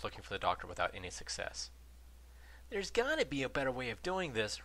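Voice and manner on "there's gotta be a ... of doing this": complaining. A narrator's reading. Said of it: "Ron complained".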